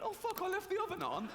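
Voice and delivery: God panicking over a small mistake; absent-minded God. Pretend. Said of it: "him going".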